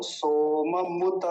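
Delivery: सोममुत stretched, full मु; very slow demo; slow careful pronunciation. Shown as a demonstration. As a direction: draw out सोममुत slowly. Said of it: "मुत ऐसा बोला जाएगा".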